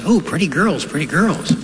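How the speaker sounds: high squawky parrot calling out; lightly voiced parrot cooing at the lady. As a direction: do the parrot, perched and flirty. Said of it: "The parrot says".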